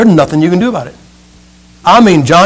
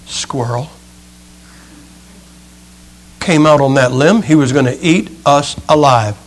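winded, upset acorn; 3 semitones lower than usual. Acted. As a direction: play a shaken little acorn in low spirits. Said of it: "He's kind of winded. He's looking a little upset".

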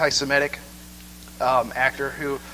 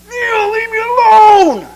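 exasperated shout; 14 dB louder, much slower than usual. Pretend. God voiced pleading to be left.

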